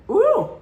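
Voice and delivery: loud gossipy squeal; scandalized ooh. Real, not acted. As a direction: savor the juicy gossip. Reaction to countess gossip.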